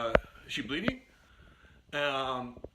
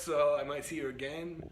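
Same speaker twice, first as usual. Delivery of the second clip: ordinary delivery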